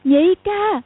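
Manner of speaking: high, loud cry; calling out loud. Acted. Sister crying out loud to her brother on seeing him. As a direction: call out to a long-lost brother. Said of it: "Cầu Thiên Xích cũng gọi to".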